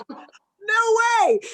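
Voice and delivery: high squealed no way; playful high-pitched refusal; laughing mock disbelief. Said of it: "I was like".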